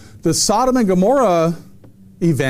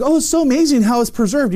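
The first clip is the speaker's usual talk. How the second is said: higher, gushing imitation of tourists; mock amazement; 7 semitones higher, faster than usual. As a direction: gush in mock wonder, pitched up. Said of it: "people are like".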